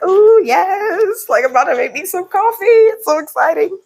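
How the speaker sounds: high, gushing put-on delight; fast, high mock gushing; mock excitement. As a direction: ham up the thrill over coffee. Acting out a gushing coffee moment.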